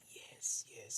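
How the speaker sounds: breathless whispered yes; whispered relief. Retold or imitated. Gorilla walking away, friends whisper relief. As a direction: whisper a silent cheer. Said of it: "we was like".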